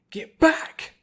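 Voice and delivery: high, loud battle yell; fierce shout. A narrator's reading. Applejack lashing out at the wolf, fierce shout. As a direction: drive the wolf off.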